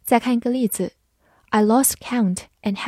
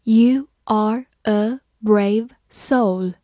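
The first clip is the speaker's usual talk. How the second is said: slow model reading, each word separate; 3 dB louder, much slower than usual. Shown as a demonstration. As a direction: read it slowly, word by word. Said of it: "好，慢慢来读". (慢慢朗读，逐词分开)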